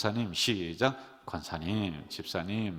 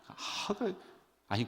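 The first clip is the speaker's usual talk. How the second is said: usual speaking voice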